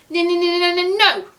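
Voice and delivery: high stuttered build-up to no. Pretend. Vlogger rejecting her own guess, playful suspenseful no. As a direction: stall on a high run of n's, then land NO.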